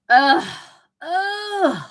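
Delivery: loud, high groans of disgust; exasperated groan. Real, not acted. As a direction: groan loudly at the hated rival. Disgust at rival Alabama team.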